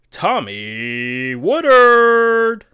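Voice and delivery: long, drawn-out booming name call; ring announcer. Acted. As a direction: stretch the fighter's name way out, loud. Blue corner name called.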